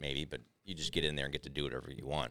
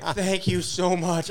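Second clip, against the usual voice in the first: mock stoned thanks; 13 semitones higher, 8 dB louder, much slower than usual. Stoned him thanking roommate.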